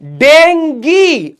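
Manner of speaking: डेंगी stretched out high, den-gee; pronunciation demo. Shown as a demonstration. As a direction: model डेंगी very slowly with a long final ee. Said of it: "इसकी pronunciation क्या होगी?".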